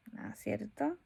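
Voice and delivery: usual speaking voice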